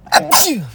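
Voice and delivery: big real sneeze; a sharp high achoo. Real, not acted. Sneezing again on camera.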